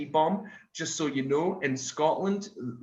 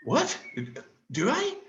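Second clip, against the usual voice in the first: surprised delight; 12 semitones higher than usual. Retold or imitated. His delighted reaction.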